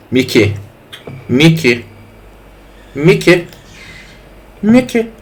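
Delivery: rising repeated calls. Pretend. Streamer calling Mickey over and over, climbing pitch.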